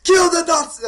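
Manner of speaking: screaming. Retold or imitated. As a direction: yell it like a frenzied extra. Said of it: "they're screaming other lines".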